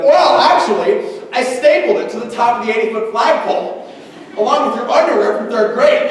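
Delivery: a smug teacher making it impossible. Retold or imitated. Imagined teacher mocking student.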